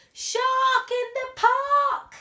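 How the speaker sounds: high, loud, slow shout. Acted. Boy spotting the shark, shouting the warning. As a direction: call it out across the park. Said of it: "He shouts".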